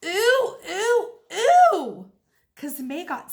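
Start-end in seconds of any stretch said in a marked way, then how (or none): 0.0-2.1 high, loud squealing ews; disgusted hollering. A narrator's reading. Junie B hollering at spittle on her cheek. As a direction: squeal each ew louder. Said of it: "I hollered".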